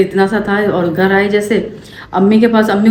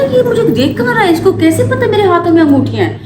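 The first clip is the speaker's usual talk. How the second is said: panicked inner thought; 10 semitones higher than usual. Retold or imitated. Panicked thought during robbery.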